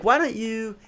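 lightly voiced smug guy, high and soft; a hint of a smarmy suitor; mocking douchey guy. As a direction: imitate a cocky guy asking a girl out. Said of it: "he's talking like this".

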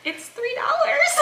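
high, slow laughing protest; drawn-out comic defensiveness; mock protest. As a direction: squeal it through laughter. Her defensive reply, retold laughing.

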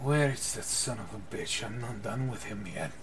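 put-on low, quiet bedridden old man; groggy old man just waking, voice down; weak old man. Old man waking in his sickbed, weak and low.

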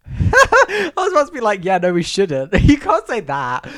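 high, loud laughing speech; cracking up at your own idea; real laughing. Real, not acted. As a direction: talk through a burst of laughter. Laughs at own blunt answer.

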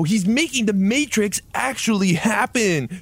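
awed Mace Windu. Acted. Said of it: "said Mace Windu".

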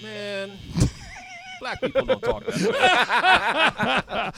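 laughing, slow drawn out words; talking through a laugh. Real, not acted.

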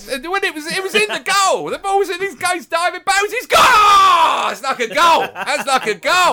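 high, loud goal-celebration yell; excited shouting. Acted. Fan celebrating a save like a goal, ecstatic high shout. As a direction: shriek it like the ball went in.

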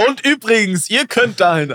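mock sponsor plug; hammed-up advert pitch; ad read voice. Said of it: "das wirkte wie so ein billiges Placement".

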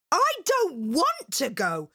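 high-pitched bratty whine; whining. Acted. Angelina refusing her mother's errand, whining. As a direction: drag it out in a high whinge. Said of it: "whined Angelina".

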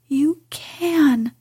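ordinary delivery, nothing marked

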